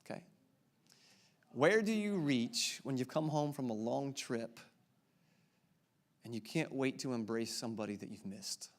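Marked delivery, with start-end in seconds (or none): 1.5-8.7 softer, slowing, sinking at the end; hushed, tender question; getting sadder. Acted. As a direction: let the question grow gentle and wistful. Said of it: "It's getting sadder, isn't it?".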